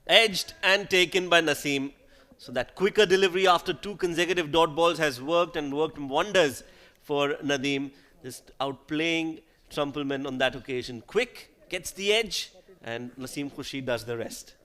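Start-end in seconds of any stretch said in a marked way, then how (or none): 0.1-7.9 loud, rising call of the wicket; excited wicket call. Real, not acted. Wicket falls, commentator exclaims. 11.1-12.5 high, slow, emphatic replay call; excited. Real, not acted. Replay of wicket, excited description.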